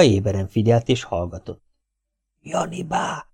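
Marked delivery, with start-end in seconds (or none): 2.4-3.3 hushed, soft warning; whispering. Acted. Gézuka warns the others in a hush. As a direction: breathe the name out softly. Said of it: "suttogta Gézuka".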